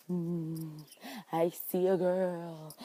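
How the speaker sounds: hushed, slow crystal-ball fortune teller; mystic fortune teller. Retold or imitated. Fortune teller gazing into her crystal ball, hushed slow voice. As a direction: lean in like a phony psychic, softly and slowly. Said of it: "she's all up in her crystal ball".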